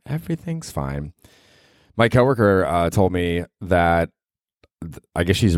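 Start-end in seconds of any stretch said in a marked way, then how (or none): 0.1-1.1 muttered irony. Real, not acted. Ironic echo, quiet.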